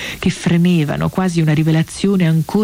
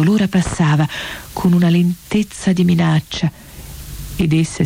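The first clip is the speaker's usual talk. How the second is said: ordinary delivery, nothing marked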